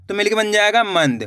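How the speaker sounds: ordinary delivery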